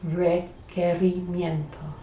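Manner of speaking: Requerimiento slowly, K sound for the QU; drawn-out Requerimiento, each syllable spoken out; slow model pronunciation. Shown as a demonstration. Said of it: "It's got a K sound".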